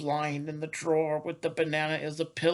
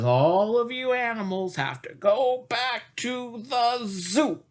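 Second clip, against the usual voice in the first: Mama Zookeeper voice; 6 semitones higher, 3 dB louder than usual. Acted.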